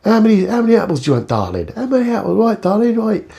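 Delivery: high, fast London market-trader patter. Retold or imitated. The vlogger plays a London market trader selling apples, high and fast. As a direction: hawk apples like a Cockney stallholder. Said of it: "It's like markets".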